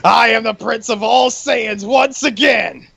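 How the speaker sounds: slow, high triumphant shout; Vegeta. Pretend. Vegeta finally done with Bulma, hammed-up triumphant proclamation. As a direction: proclaim it grandly, every word drawn out. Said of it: "when he's finally done with Bulma, he says".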